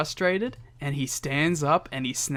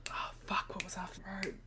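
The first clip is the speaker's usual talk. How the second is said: whisper; 2 semitones higher, 17 dB quieter, faster than usual. Retold or imitated. Forgetting lines mid-promo.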